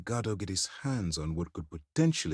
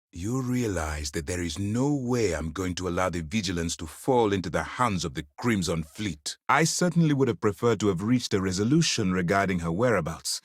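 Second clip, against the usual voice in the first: ordinary talk, nothing marked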